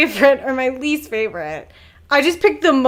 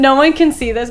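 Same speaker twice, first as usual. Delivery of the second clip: ordinary talk, nothing marked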